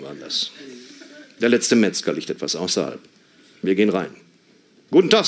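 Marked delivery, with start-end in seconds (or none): 4.9-5.3 bright greeting. Retold or imitated. Last butcher visit greeting.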